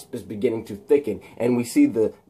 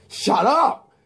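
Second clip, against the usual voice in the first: mock shout; 11 semitones higher than usual. Villain snapping at hero.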